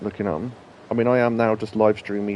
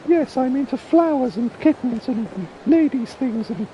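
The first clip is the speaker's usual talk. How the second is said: comic drag lady. Retold or imitated. Quoting a comedy sketch in a high drag lady voice. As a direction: squawk it like a man in a dress. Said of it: "so he went".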